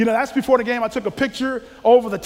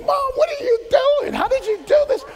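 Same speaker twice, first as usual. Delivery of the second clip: much higher, loud, crying outrage; exasperated son; 6 dB louder than usual. Retold or imitated. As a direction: wail it at mom like a mortified teenager. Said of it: "he's like".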